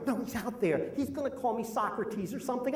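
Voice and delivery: soft, mock-panicked protest; hushed panic. Hushed panic to his wife.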